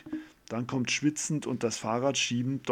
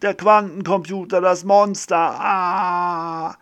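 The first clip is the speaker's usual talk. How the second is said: high, loud, frantic shouting ending in a cry; panicked cry; 8 semitones higher, 10 dB louder, slower than usual. Acted. Professor tells Lilly about the monster, panicked and shouting. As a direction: yell it out in sheer panic.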